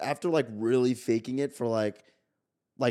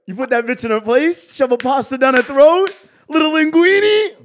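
mock tough guy yell; 13 semitones higher, 10 dB louder than usual. Mock tough talk about girlfriend.

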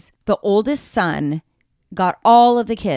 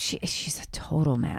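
whisper; 5 semitones lower, 8 dB quieter than usual. Real, not acted. Gossip aside.

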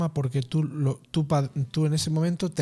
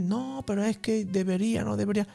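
the nagging mind; 6 semitones higher than usual. Retold or imitated. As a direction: play the mind making excuses. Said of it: "la mente intenta sacarte de ahí".

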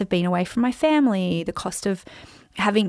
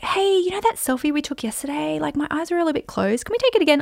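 imitated eager fan; 7 semitones higher than usual. Fan asking the speaker to retake yesterday's selfie, imitated eagerness. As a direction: put on a higher voice for a sweetly asked favour.

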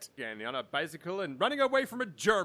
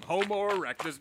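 normal talking voice

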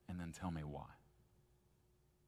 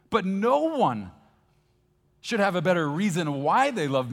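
much higher, loud pleading wife voice; exasperated wife; 14 semitones higher, 17 dB louder than usual. Acted. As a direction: play the frustrated wife begging for a reason. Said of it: "before she finally would say".

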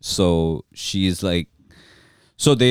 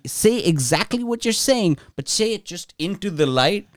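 high, prim voice interrupting politely; mocking girlfriend; 8 semitones higher than usual. Asia interrupting a grieving refugee, high prim mock voice. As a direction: parody a fussy director breaking in. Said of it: "She says".

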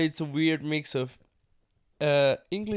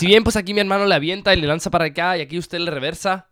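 louder, fast Mexican Spanish impression; Mexican Spanish accent; 4 semitones higher, 10 dB louder, faster than usual. Shown as a demonstration. Imitating Mexican wrestlers calling moves, fast Mexican Spanish accent. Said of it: "That's gonna be my best impression".

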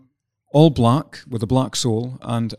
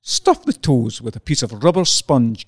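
book tip read-aloud; 2 semitones higher, 3 dB louder than usual. Acted. Host reading the book's tip to listeners, measured read-aloud. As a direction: read it out brightly and deliberately, like instructions.

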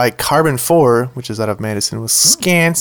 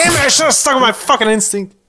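frustrated outburst; 10 semitones higher than usual. Real, not acted. Losing the beer quiz.

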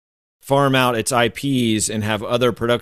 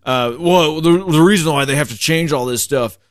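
raised, put-on voice of a whiny online commenter; mock bigoted commenter; 4 semitones higher than usual. Mocking the comment section in a higher put-on voice. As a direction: sneer it out like a know-it-all troll.

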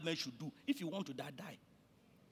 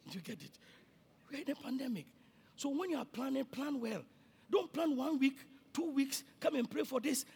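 ordinary talk, nothing marked

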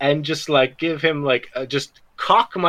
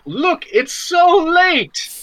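drawn-out high mock yawn line; exaggerated fake excuse; 10 semitones higher, much slower than usual. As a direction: overplay the lateness to escape. Character faking tiredness.

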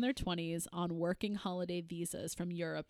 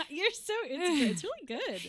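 laughing; 11 semitones higher, 5 dB louder than usual. Real, not acted. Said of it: "end every sentence like you're asking a question".

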